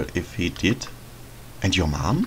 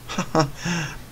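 witch giggle; 7 semitones higher, 4 dB quieter than usual. Acted. Witch amused by warning signs.

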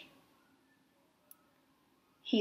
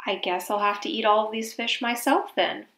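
plain reading voice

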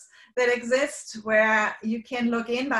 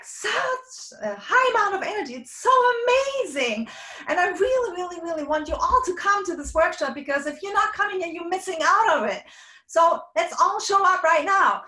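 much higher and louder, gushing hype; overhyped high energy; 9 semitones higher, 5 dB louder than usual. Shown as a demonstration. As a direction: sell the workshop like an over-the-top hype host. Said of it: "that's not authentic anymore".

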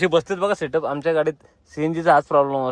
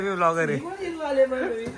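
drawn-out line through a real laugh; much slower, amused and laughing; 8 semitones higher, 4 dB quieter, much slower than usual. Real, not acted. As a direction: stretch it slowly while you laugh. Jokingly claims to be blogger.

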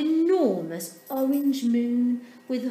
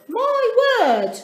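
much higher and louder delight; delighted big man; 14 semitones higher, 12 dB louder than usual. A narrator's reading. Big man spots Dudley, delighted and loud. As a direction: gasp it out in delight. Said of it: "said the great big man".

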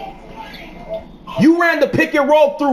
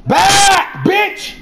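angry shout; 6 semitones higher than usual. Real, not acted. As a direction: bark it out in real fury. Angry teammate yelling during game.

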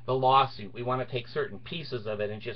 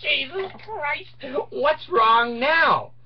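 very slow, high, drawn-out groan of a question; exasperated judge; 13 semitones higher, 4 dB louder, much slower than usual. Retold or imitated. Judge fed up with the Bard's delay, slow exasperated whine. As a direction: stretch it out like you're fed up.

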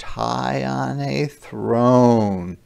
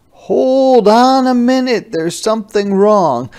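much higher, louder hold on; sudden halt; 10 semitones higher, 5 dB louder than usual. A narrator's reading. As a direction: stop yourself mid-idea. Sudden stop in his daydream.

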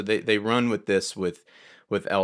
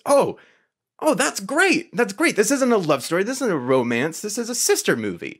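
much higher, louder, thrilled realisation; delighted inner voice; 11 semitones higher, 3 dB louder than usual. Retold or imitated. Podcaster voicing his own delight at the twist, much higher and thrilled. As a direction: gush like a fan who just got it. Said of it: "was just".

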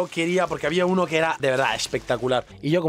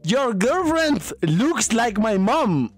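mock taunts; 4 semitones higher, 4 dB louder, slower than usual. Taunting insults aimed at Tyler.

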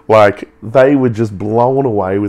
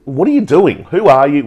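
higher, louder, mock-astonished quizzing; amazed questioning; 6 semitones higher, 6 dB louder than usual. Millionaires baffled by a keen seventeen-year-old, higher and louder amazement. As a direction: play the stunned millionaires firing questions.

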